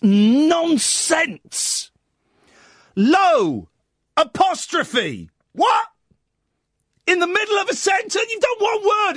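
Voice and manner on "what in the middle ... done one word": high, fast exasperated shout; incredulous outburst. Real, not acted. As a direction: blurt it out in disbelief. Ranting at the lyric.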